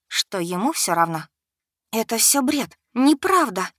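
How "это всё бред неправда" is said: strangled whisper. A narrator's reading. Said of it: "прошептала сдавленно".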